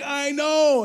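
high, thrilled acted exclamation; excited reply. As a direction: play the boy amazed at his own voice. Healed boy answers his amazed friends.

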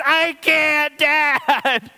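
high, loud mock wail to dad; crying, screaming child. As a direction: scream it like a sobbing four-year-old. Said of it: "screaming at the top of her lungs".

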